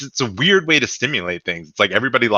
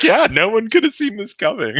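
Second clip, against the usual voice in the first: high, laughing parrot of the line; mock Republicans; 13 semitones higher, 3 dB louder than usual. Podcaster mocking Republicans echoing Trump, high and laughing. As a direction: echo it like a yes-man, giggling. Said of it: "Republicans will be like".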